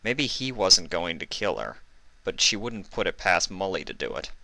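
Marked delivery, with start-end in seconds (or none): none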